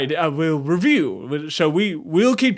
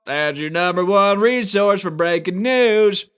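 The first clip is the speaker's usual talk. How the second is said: news anchor voice; 4 semitones higher, slower than usual. Acted. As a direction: open the broadcast like a pompous anchor. Newscaster opening the show.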